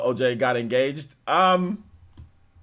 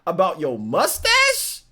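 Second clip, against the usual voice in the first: incredulous bro; 9 semitones higher, 5 dB louder than usual. Retold or imitated. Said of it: "And I'm like".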